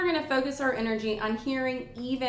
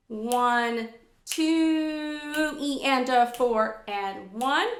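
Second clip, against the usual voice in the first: measured count with "two" held long; counting sixteenths; much slower than usual. Shown as a demonstration. Teacher counting through the tie, in strict measured rhythm. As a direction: hold "two", then chant "E and a" in time. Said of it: "we see sixteenth notes".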